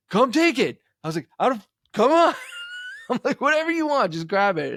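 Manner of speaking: high, loud call down the street; calling out to a passerby. Retold or imitated. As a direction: shout to the car slowing down. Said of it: "And I was like".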